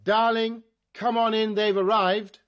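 loud summons, calling across the house. Acted. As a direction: shout for her to come in. Calling robot from another room.

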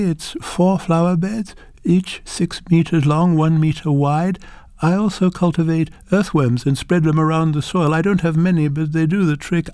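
a humble farmer showing off her harvest; proud grandmother. Acted. Grandmother describes her garden.

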